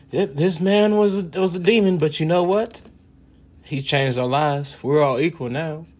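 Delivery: a hammed-up grateful descendant; mock reverent eulogy. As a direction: speak of him like a legend. Imagined future people praising him.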